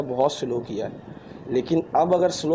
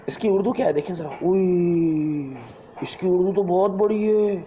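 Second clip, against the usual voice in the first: mock dismay; 3 semitones higher than usual. Mock dismay at long text.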